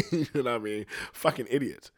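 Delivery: normal talking voice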